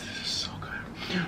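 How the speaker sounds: soft slow whisper to viewers. Real, not acted. Leaning in to tell viewers the food is great, whispering. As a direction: share it like a secret.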